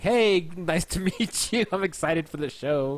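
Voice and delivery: slow, nervous laugh through a hello; awkward laughing greeting. Retold or imitated. Meeting the band, awkward laughing hello. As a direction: stumble over meeting the band. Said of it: "And I'm like".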